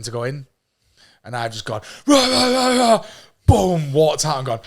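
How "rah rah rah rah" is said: loud high mock roars; roaring. Storming out past waiting candidates, loud high roaring. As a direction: re-enact the rampage with repeated roars. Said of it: "to hear someone roaring".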